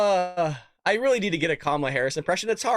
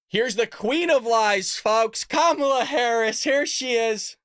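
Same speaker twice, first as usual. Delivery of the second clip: mocking announcement; 9 semitones higher, 5 dB louder, slower than usual. Real, not acted. Announcing the clip with mockery.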